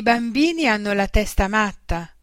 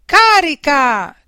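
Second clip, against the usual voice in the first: loud, high, drawn-out charge call; thundered battle cry; 6 semitones higher, 9 dB louder, slower than usual. A narrator's reading. Boy leading his invisible army, thundering war cry. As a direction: thunder it like a child commander. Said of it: "tuonò lui".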